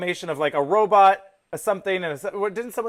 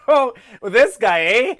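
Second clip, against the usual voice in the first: louder, laughing through a Canadian "eh"; laughing Canadian accent; 3 semitones higher, 5 dB louder than usual. Retold or imitated. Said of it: "lean into it".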